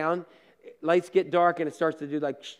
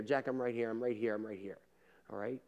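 a promise dissolving into a hush; fading to a whisper; 9 semitones lower, 14 dB quieter than usual. Pretend. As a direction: repeat it softer each time until it is almost a whisper. Voices Jesus comforting the believer.

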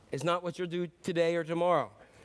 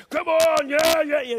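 full shout, much higher and louder; screaming spotter; 9 semitones higher, 10 dB louder, slower than usual. Retold or imitated. Donnie screaming at his friend in the gym, full shout. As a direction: scream him through the last rep. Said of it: "He's screaming in the gym".

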